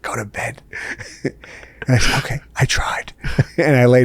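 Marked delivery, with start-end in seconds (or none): 0.0-0.6 soft whispered brush-off; whispered reply. Retold or imitated. Wife woken by her husband at night, whispering. 2.2-3.1 whispered giving up. Retold or imitated. Husband giving up on chatting with sleeping wife, whispering.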